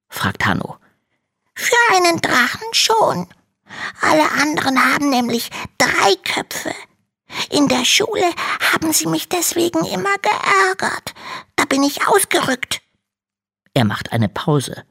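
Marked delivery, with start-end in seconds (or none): 1.5-12.8 very high small voice with a cry in it; a tiny dragon near tears; tearful little dragon. Acted. Little dragon tells Hanno why he ran, teary. Dragon explains running away.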